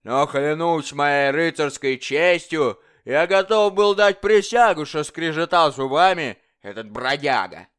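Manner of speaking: loud, high, mocking tyrant king; bully the dwarf like a drunken king; boisterous royal bully demanding fun. King voiced in story.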